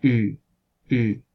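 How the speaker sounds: Ü said as a centralized u between u and ü; Ü with lips rounded, tongue halfway; barred u demo. Shown as a demonstration. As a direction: hold a rounded central vowel, Ü. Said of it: "sino que suena más bien Ü".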